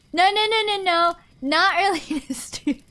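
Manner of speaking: high, loud string of nos; rapid high protest; emphatic no. Real, not acted. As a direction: shoot it down fast and high. Denying being early to stream.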